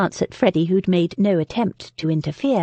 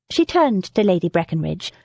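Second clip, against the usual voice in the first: usual speaking voice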